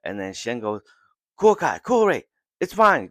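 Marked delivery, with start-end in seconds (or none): 1.4-3.1 high, loud call over the battle; calling out reassurance. Retold or imitated. Shen, calling to his hurt comrades mid-charge, loud and high. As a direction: shout to them that it's fine.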